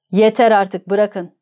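usual speaking voice

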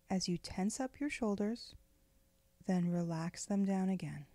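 usual speaking voice

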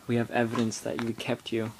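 ordinary delivery

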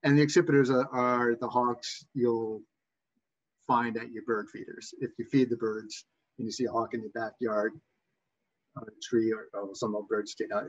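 ordinary delivery

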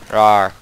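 loud playful battle roar; mock roar. Gamer roaring at zombies while shooting, loud mock roar. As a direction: roar at the horde.

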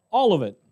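"All of it" said firmly as the congregation's answer; emphatic all. Real, not acted. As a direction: punch "All of it" home. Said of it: "It's not just some of it".

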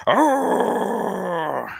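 high drawn-out monster shriek; imitated monster shriek. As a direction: let out a god-awful howling shriek. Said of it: "this god-awful shrieking".

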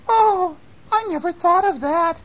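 put-on very high old-lady exclamation; high-pitched storybook surprise; surprised old lady. A narrator's reading.

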